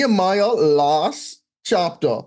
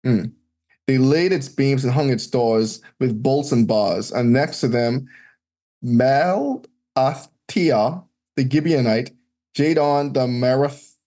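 scripture reading; 5 semitones lower than usual. Acted. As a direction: read out the old names like a lector. Reads scripture list aloud.

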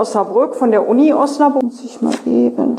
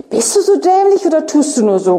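quick, higher, lightly voiced irritated growl; 6 semitones higher, much faster than usual. A narrator's reading. Kropp snapping at Tjaden in the reading, mild mock growl. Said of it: "knurrt Kropp".

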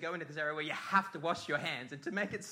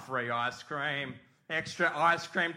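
ordinary talk

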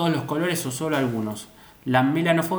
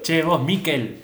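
imitating Sandrita; 3 semitones higher, slower than usual. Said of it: "Sandrita le pone acento en la M".

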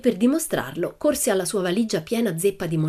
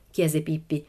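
ordinary delivery

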